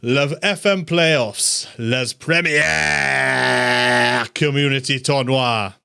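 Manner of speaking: high, slow, showy French host intro; hammed-up French. Host welcomes viewers in hammed-up French, high and slow. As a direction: play it like a grand French announcer, drawn out. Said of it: "that's about all the French I can do".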